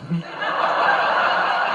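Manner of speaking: quiet breathy chuckle; amused chuckle; soft laugh. Real, not acted. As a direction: laugh at the memory. Speaker laughs at own story.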